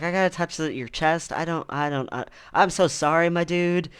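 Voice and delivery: retold awkward sorry from a baffled guy; confused, apologetic. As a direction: imitate a flustered, stammering apology. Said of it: "he was so confused why".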